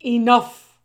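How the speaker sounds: one loud word of authority; commanding shout. A narrator's reading. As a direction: silence an unruly crowd. Head mouse silencing the mob.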